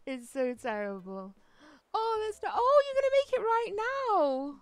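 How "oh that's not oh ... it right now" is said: ordinary talk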